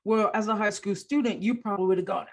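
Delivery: normal talking voice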